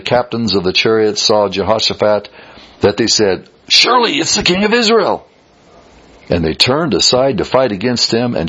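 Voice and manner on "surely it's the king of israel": excited soldiers' cry. Acted. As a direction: shout it up high, as if spotting the prey. Enemy captains spot the king.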